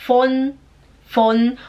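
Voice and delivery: slow, isolated "Von"; slow demonstration. Shown as a demonstration. Teacher leading learners slowly through the phrase, deliberate. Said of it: "Vamos falar devagar?".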